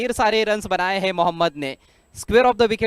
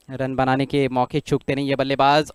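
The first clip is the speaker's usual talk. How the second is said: ordinary delivery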